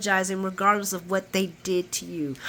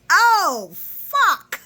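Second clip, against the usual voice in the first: high, loud, drawn-out exclamation; dramatic shock; 10 semitones higher, 5 dB louder than usual. Retold or imitated. Viewer reacting to the fight going down, mock shock. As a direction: stretch it out in hammed-up dismay.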